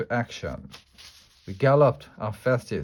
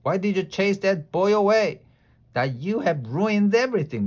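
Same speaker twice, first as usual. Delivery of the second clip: high, louder angry complaint; angry holler; 9 semitones higher, 4 dB louder than usual. A narrator's reading. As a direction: scold them for ruining it. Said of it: "came stomping at us, very angry".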